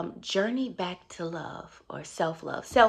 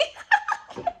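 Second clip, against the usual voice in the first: laugh. Real, not acted. Laughs after catchphrase.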